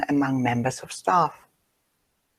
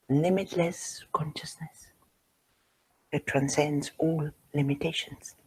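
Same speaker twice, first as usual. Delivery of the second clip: soft and measured, with a long pause midway; hushed contemplative repeat; 9 dB quieter than usual. Real, not acted. Speaker repeats a teaching for listeners to ponder, hushed and reverent. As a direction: let each phrase sink in, hushed.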